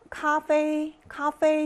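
카페 held high and level on both syllables; first tone. Shown as a demonstration. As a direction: draw out 카페 slowly on a high first tone. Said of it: "일 성이니까".

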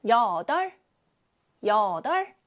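여덟 said slowly as yeo-deol; drawn out 여덟 for the class; slow pronunciation demo. Shown as a demonstration. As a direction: model 여덟 slowly. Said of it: "여덟이라고 소리가 나요".

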